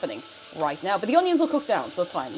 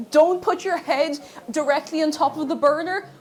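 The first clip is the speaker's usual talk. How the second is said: ordinary talk, nothing marked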